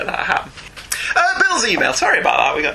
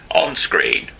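very low, commanding captain order; starship captain; 14 semitones lower than usual. Pretend. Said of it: "alien in Star Trek".

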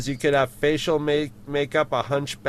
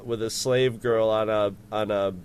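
ordinary talk

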